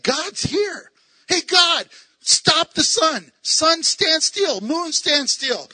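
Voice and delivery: high, loud and slow proclamation; excited shout. Retold or imitated. Excited Joshua calling God to stop the sun. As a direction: shout it out, jazzed and bold.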